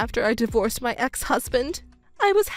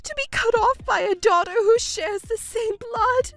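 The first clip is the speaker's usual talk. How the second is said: distraught wailing; 8 semitones higher than usual.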